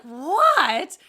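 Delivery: loud what. Retold or imitated. Retold shout across court.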